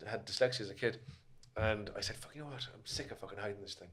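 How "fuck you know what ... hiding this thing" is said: muttered under the breath, much softer; quiet resolve. Retold or imitated. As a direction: say it low to yourself, fed up. Retells his own decision.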